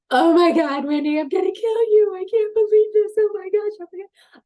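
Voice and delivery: high, loud panicked shrieking; terrified screaming. Retold or imitated. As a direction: scream at your friend on a roller coaster. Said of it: "she's like".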